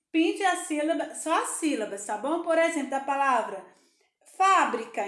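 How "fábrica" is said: "fábrica" with a loud "fá"; stressed first syllable. Shown as a demonstration. Teacher giving pupils an example to colour, stress on "fá". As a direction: punch the "fá" in "fábrica". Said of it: "você vai pintar a sílaba fá".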